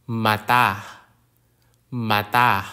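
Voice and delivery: "Mata" as an isolated model word, weight on TA; low, deliberate "Mata"; glottal stop demo. Shown as a demonstration. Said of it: "at the end of words ending with vowels".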